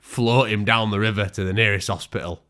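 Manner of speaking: normal talking voice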